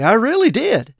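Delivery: high, eager assurance; mildly breathless enthusiasm. A narrator's reading. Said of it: "I answered breathlessly".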